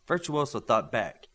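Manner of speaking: ordinary delivery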